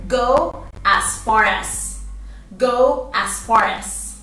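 slow, clear teaching repetition; patient teacher modelling a phrase; slow model phrase. Shown as a demonstration. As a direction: Go as far as, each word slow and clear. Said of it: "Vaya hasta tal lugar".

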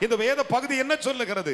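preacher's challenge. Real, not acted. Preacher presses congregation on the scripture.

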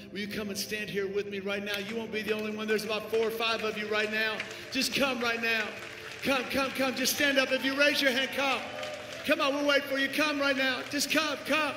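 high, loud shouted preaching; full-force altar call. Real, not acted. Preacher urging hesitant people to come forward, loud impassioned shout.

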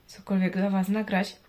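ordinary talk, nothing marked

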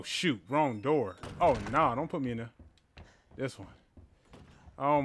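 ordinary talk

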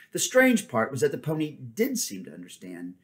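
plain reading voice

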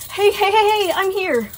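high, gentle reassuring; calm a scared child; soothing. A narrator's reading. Sun comforts blind girl.

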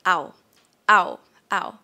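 "Ow" cut short; short vowel. Shown as a demonstration. Teacher shows the short version, brief "Ow". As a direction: say "Ow" quickly, without stretching it. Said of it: "short version of ow".

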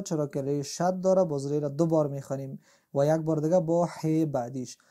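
ordinary delivery

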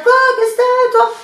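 high, loud, sing-song taunt; mock aggressive retort. Imagined snapping back at the driver, high sing-song mockery. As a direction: fake picking a fight in a mocking tune. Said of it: "au lieu de m'énerver".

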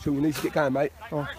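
low, soft speaking voice; dropped-voice remark; quiet aside. Real, not acted. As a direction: say it confidentially, close by.

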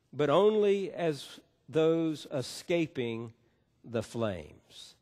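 measured, formal reading of scripture. Acted. Pastor reading scripture to congregation, formal and measured. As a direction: read the passage to the congregation with weight.

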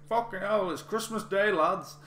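high, loud mock northern shout; broad northern accent. Someone shouting at two guys kicking off, broad northern accent. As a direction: imitate a thick-accented northern yell. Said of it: "it was just a really thick, broad northern accent".

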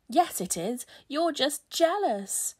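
loud taunt, stretched at the end; shouted retort. A narrator's reading. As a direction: jeer back like a squabbling child. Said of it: "shouted the spiders on Speedy's team".

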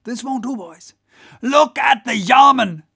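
high, loud goading of the rowers; rousing cry. Acted. Whaler to his crew racing the German boat, rousing cry. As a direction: whip up the crew, swelling to shouts. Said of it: "cried Stubb to his crew".

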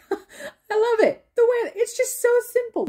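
delighted high laugh through the words; can't stop laughing as you say it; laughing out loud with delight. Real, not acted. Laughing while praising scene.

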